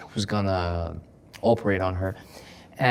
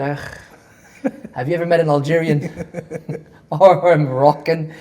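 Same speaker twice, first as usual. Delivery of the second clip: laughing, louder, high retort; laughing, mock-challenging reply; 7 semitones higher, 5 dB louder than usual. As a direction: toss the question back while laughing.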